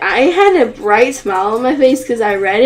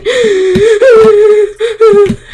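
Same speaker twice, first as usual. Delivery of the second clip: high, loud burst of laughter; nervous laugh; 9 semitones higher, 7 dB louder than usual. Real, not acted. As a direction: laugh out the tension. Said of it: "I wanna laugh".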